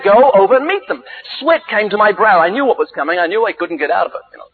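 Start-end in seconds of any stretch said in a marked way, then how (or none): 0.0-1.0 insistent inner voice. Retold or imitated. God's prompting comes back to the reluctant preacher, insistent. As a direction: repeat the call higher and more insistently. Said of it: "that still small voice came back".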